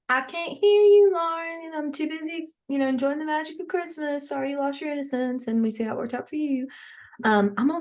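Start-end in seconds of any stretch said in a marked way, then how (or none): 0.1-6.7 elf voice. Pretend. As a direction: taunt Lauren as a smug elf. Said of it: "The elf's, like, saying".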